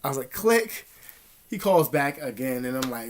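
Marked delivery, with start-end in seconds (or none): 0.3-0.9 spoken click sound. Retold or imitated. Hanging up the phone.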